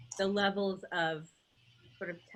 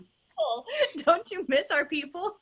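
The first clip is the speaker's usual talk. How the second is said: laughing through tears; 11 semitones higher, 8 dB louder than usual. Real, not acted. Missing colleagues, laughing tearfully.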